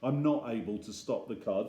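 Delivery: ordinary delivery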